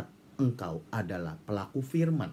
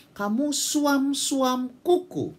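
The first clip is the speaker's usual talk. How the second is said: dramatic rebuke; 12 semitones higher, 3 dB louder, much slower than usual. Acted. God's rebuke quoted dramatically.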